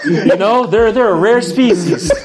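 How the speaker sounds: mock presentation, cracking up; laughing through it. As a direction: play it straight but keep laughing.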